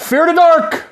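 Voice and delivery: high, loud rock-anthem call of the title; belted toast. Pretend. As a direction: shout the beer name like a metal chorus. Toasting with the beer name.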